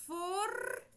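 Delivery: formiga with the or syllable pitched high and the R sounded out; R sound demo. Shown as a demonstration. Teacher pointing out the R in formiga, lifted and stretched. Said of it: "o R junto com o F, o O".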